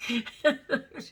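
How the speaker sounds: slow, laughing through the words; helpless overtired laughter. Real, not acted. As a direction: let the laugh take the words. Laughing, drawn out.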